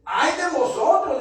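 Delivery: loud, high cry of woe; angry rebuke. Acted. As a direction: denounce them as an angry Jesus. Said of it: "Jesús estaba enojado".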